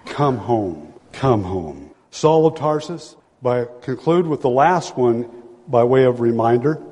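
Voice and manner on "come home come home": soft, low, gentle invitation; lower, softer pastoral appeal; tender plea. Real, not acted. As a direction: call them home gently.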